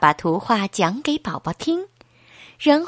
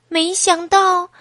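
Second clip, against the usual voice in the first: usual speaking voice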